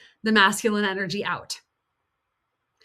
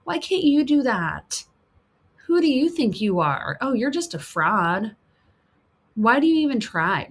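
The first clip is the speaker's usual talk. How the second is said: higher, needling critic voice; inner critic; 4 semitones higher than usual. Acted. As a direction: sneer it like the voice in your head. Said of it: "that voice that goes".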